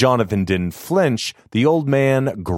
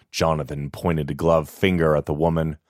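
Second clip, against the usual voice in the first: plain reading voice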